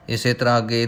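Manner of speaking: ordinary talk, nothing marked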